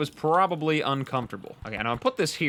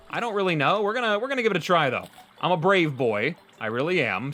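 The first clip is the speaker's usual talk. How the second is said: usual speaking voice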